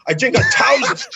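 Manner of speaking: loud, high-pitched teammate impression; hammed-up booming boast; George. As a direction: blurt it out as an indignant, over-the-top caricature. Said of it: "And he's like".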